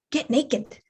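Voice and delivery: understated command from a voice inside; mysterious inner voice. Acted. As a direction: drop it in quietly, like a sudden thought. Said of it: "I hear this voice and it says".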